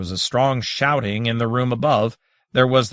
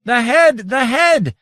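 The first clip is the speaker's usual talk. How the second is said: much higher, louder fiery cry; scorching cry; 12 semitones higher, 6 dB louder, slower than usual. A narrator's reading. Daytorch crying about the head, scorching cry. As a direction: scorch the words out. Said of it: "Daytorch flamed and scorched".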